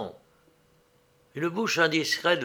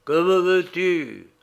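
slow, high, hollow ghostly voice; sepulchral ghost voice; 4 semitones higher, 4 dB louder, slower than usual. A narrator's reading. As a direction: speak like a ghost from the tomb. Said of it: "lui dit d'une voix sépulcrale".

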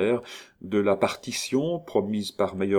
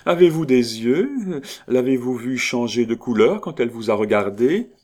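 loud, high, quick mocking laugh in the voice; laughing mockery; 6 semitones higher, 7 dB louder than usual. Countess mocking a timid lover, loud laughing scorn. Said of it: "La comtesse éclata de rire".

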